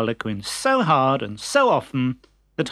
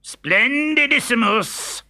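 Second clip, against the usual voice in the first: pompous doctor; 10 semitones higher, slower than usual. Acted. Pompous doctor admires himself.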